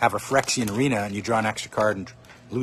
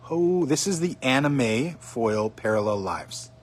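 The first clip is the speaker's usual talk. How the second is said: ordinary talk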